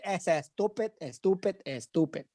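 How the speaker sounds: put-on hushed, playful Spanish accent. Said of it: "I'll say it in an accent".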